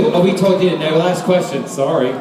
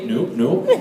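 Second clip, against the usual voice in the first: low, clipped mock-innocent denial; quick low-pitched fib; retold child denial; 10 semitones lower, 3 dB quieter than usual. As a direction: play a guilty boy brushing it off. Child denying it to dad.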